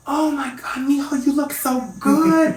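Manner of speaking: high-pitched, drawn-out mock gushing; gushing Latin ladies. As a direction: coo slowly and high like an adoring Latin auntie. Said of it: "and they go".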